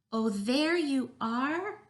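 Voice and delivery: high, loud, drawn-out cry of discovery; exclaiming on sight. A narrator's reading. Bird spots the missing chicken, exclaiming. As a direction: stretch it out as you spot the lost one. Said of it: "it exclaimed when it saw".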